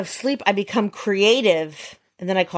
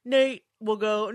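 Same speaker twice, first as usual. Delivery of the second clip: ordinary delivery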